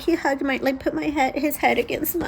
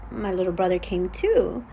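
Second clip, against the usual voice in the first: ordinary talk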